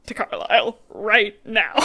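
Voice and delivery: slow, deliberate retold demand; mock anger. Bella demanding the doctor on the phone, mock-mad. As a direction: stretch it out as a mock-mad order. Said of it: "like, gets mad".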